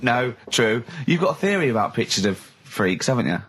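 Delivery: normal talking voice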